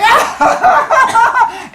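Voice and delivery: very high, loud scream; hammed-up shriek of panic; mock scream. Fleeing kid in retold story.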